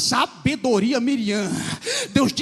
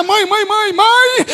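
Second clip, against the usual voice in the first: higher, louder cries of mãe; urgent calling; 6 semitones higher, 6 dB louder, much slower than usual. Acted. Miriam calling her mother home, urgent loud cries. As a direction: call out to mother breathlessly.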